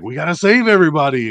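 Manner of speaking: eager Kamala quote. Retold or imitated. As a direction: urge them all to be saved. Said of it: "and she's like".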